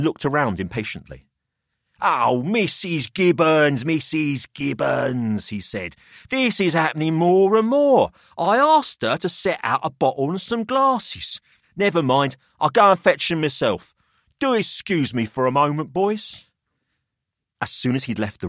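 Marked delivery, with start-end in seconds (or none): 2.0-5.5 slow, exasperated calling out; Pelham calling. Acted. Pelham calling for his absent housekeeper, exasperated. 6.3-16.5 put-on higher, smug older host; a wry businessman weighing up the boys; Pelham's voice.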